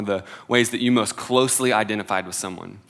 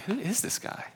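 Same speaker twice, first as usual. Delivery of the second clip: amazed wonder; 11 semitones higher, 10 dB quieter than usual. Retold or imitated. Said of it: "And I'm like".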